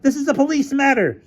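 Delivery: high-pitched, indignant rebuff; angry snap. Acted. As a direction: pull rank, snapping. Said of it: "snapped Featherstone".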